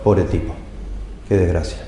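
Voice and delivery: soft, low "Pobre tipo, qué desgracia"; mild pity. Shown as a demonstration. Preacher voicing a bystander pitying the drunkard, soft and low. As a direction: sigh it out in gentle pity. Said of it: "y el otro".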